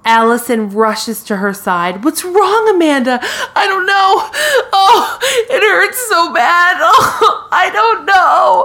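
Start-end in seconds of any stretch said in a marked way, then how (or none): 2.0-3.2 high, hammed-up worried friend; high-pitched pretend panic; mock alarm. Alarmed friend in retold scene. 3.2-8.6 high, hammed-up wailing in pain; mock agony. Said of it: "starts having a pain in the side".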